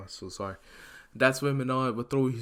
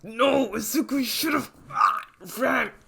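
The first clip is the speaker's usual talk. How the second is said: pained warning; 11 semitones higher than usual. Acted. Injured teacher warning student.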